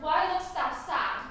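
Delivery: ordinary delivery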